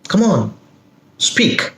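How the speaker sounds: impatient coaxing "Come on, speak"; urging. Shown as a demonstration. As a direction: push them to talk. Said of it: "I am not very happy you are not talking".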